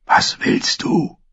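slow, furious whisper; hushed, irritated demand; angry whisper. A narrator's reading. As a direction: whisper it angrily so nobody else hears. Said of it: "fragte Gendry leise und wütend".

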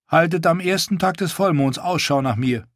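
a lightly voiced proud wildling woman; Val's voice.

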